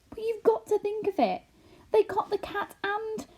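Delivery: high, anxious rush. A narrator's reading. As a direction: press the frightening memory on him. Homily insists anxiously.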